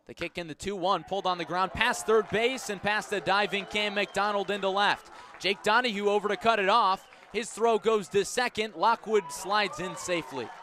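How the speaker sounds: play-by-play voice climbing with the action; excited play call. Real, not acted. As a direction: call the double with building urgency. Live hit call, runner to second.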